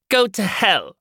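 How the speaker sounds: rejected woman shouts after him, loud and high; angry curse. Acted. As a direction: fling it at his back.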